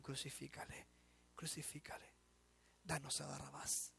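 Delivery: multitud susurrando. Retold or imitated. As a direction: whisper the mob's demand ominously. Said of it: "la multitud puede estar gritando". (la multitud que exige en susurros)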